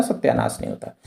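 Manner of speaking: a near-silent whisper tossed off under the breath; teacher whispering a playful aside to the class. Real, not acted. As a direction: drop to a whisper for the joke option. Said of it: "teacher's whispered joking aside".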